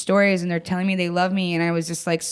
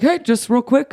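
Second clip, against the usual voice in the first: higher, louder offhand opener; breezy caller imitation; 7 semitones higher, 6 dB louder than usual. Brother phones sister with news, breezily casual. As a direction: toss it off like a minor errand.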